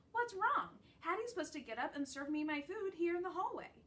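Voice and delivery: puzzled pup demanding his dinner; indignant puzzlement. A narrator's reading. Dog indignant at waiting.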